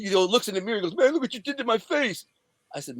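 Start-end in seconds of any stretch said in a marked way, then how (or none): 1.0-2.3 high mock wail; imitated distressed man. Beaten man looking in the mirror, high imitated wail. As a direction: wail it up high, mimicking him. Voices man seeing his face.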